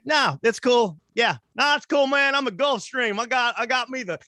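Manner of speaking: put-on high, twangy good-old-boy voice; Southern yokel. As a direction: brag like a proud country bumpkin. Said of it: "They're like".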